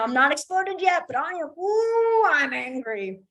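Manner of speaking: hammed-up boiling rage with a strained ooh; simmering anger. Shown as a demonstration. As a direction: hold the anger in until it bubbles up. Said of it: "a lot of times you make that".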